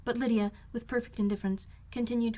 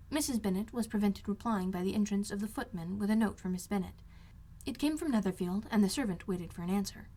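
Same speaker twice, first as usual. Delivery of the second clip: usual speaking voice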